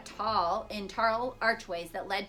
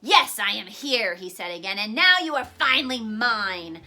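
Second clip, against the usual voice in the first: gloating villain; 7 dB louder than usual. A narrator's reading. Villain gloats, grabbing boy.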